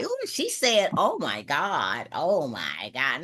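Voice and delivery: hammed-up scandalized gasping; scandalized listener. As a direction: mimic a judgmental gossip, drawn out. Mimics a scandalized, judging listener.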